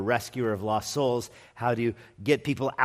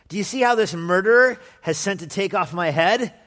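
higher, louder prophet voice; Elisha's warning; 6 semitones higher, 6 dB louder than usual. Acted. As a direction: tell the elders, raised and pitched up. Elisha tells elders of murderer.

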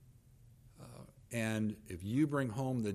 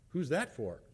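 a mild edge of suspicion; lightly mock-suspicious question; pointed tone; 5 semitones higher than usual. Husband eyeing the soup, mildly suspicious tone. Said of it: "There was a tone to that".

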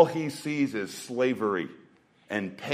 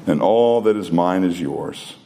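gentle father; 5 semitones lower than usual. Acted. Father gently answering his son.

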